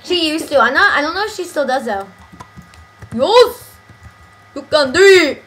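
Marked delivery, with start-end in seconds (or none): 3.1-3.7 high, loud drawn-out imitation; Haley. Friend mimics how a girl they know says yes, loud and high. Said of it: "Doesn't she say yes?". 4.5-5.4 loud, hammed-up Southern cheer; reading teacher impression. Friend imitates a reading teacher's catchphrase, loud mock encouragement. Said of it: "reading teacher. She's just like".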